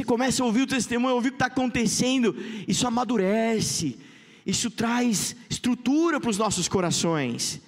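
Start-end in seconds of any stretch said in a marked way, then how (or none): none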